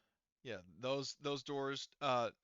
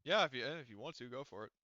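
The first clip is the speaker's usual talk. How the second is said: normal talking voice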